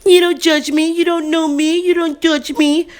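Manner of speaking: high, fast, defensive protest; mock her whiny excuses; imitating the mother making excuses. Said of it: "I was hot with her".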